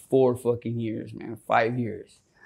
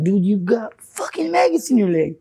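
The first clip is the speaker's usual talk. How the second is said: raised, alarmed call-out; alarmed disbelief; 7 semitones higher, 3 dB louder than usual. Retold or imitated. Speaker telling homie about maggots, alarmed. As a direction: warn a friend in shock. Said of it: "And I was like".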